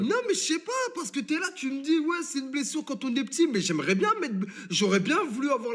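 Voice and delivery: high, incredulous protest, voice jumping up; exasperated protest. Real, not acted. Arguing with his Discord caller, high exasperated protest.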